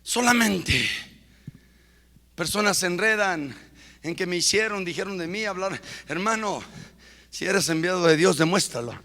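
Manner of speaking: low, soft, intimate sermon voice; hushed preaching. Acted. Preacher speaks softly to the congregation. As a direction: draw them in quietly.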